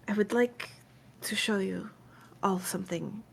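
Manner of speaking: soft, halting, fading toward a whisper; hushed, uneasy admission; hesitant Fawn confiding. Acted. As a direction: confide it cautiously, with long pauses. Game master voices Fawn hesitantly confiding.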